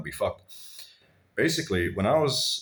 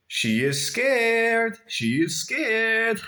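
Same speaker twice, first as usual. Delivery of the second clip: much higher, slow, drawn-out taunt; mocking ex-wife; much slower than usual. Father's ex-wife teasing him, high and slow in mockery. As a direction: sing it out high and slow to provoke. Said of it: "She was like".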